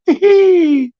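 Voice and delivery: high, loud mock wolf-whistle whoop; excited whoop. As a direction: whoop like lads cheering at a girl. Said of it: "and all the boys are like".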